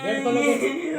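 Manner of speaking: loud, high shout; calling out. Real, not acted. Worker shouting for someone to open the gate. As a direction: yell for the gate.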